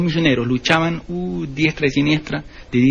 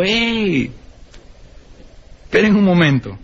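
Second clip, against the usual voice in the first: Pharaoh calling out; 5 semitones higher, slower than usual. Acted. Pharaoh calls Moses back.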